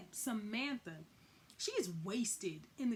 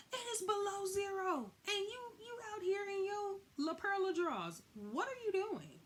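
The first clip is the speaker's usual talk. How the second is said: higher, disbelieving; incredulous; 10 semitones higher than usual. Real, not acted. Incredulous commentary on Samantha.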